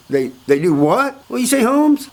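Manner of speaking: rising to a high-pitched, baffled question; incredulous older Mexican. Retold or imitated. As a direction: imitate an older man who can't believe his ears. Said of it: "This is an older Mexican".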